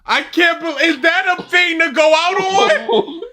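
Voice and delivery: high-pitched, cracking-up disbelief; laughing incredulity. Real, not acted. Baffled viewer reacting to three garbled seconds, laughing incredulously. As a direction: wail it high, helpless with laughter.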